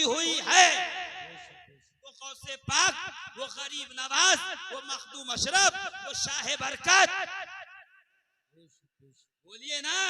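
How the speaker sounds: loud, rising sermon cry; impassioned Urdu preaching, loud and high; preaching at full force. Acted. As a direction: build the crowd with a roaring preacher voice.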